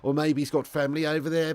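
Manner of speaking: ordinary delivery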